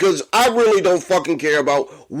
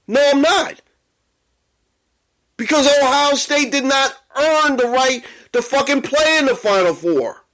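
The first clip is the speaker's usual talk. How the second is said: heated rant; 6 semitones higher than usual. Real, not acted. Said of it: "really hyped up and really angry".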